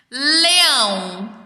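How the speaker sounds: LEÃO drawn out on the final ão; ending sounded out. Shown as a demonstration. Teacher showing children a rhyme, ending sounded out. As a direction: stretch "Leão" to show its ending. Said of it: "tem a mesma terminação sonora".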